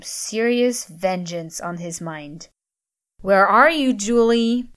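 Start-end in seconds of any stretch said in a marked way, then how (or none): 3.2-4.7 loud angry shout across the house; bellowing. A narrator's reading. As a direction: call out her name, loud and angry. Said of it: "he bellowed".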